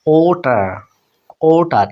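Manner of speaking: "ওটার" said o-ta, r dropped; silent final r. Shown as a demonstration. Teacher shows learners the written r stays silent. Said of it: "উচ্চারিত হবে না".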